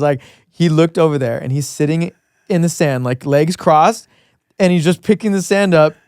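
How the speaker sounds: ordinary talk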